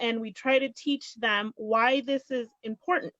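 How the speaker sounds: ordinary talk, nothing marked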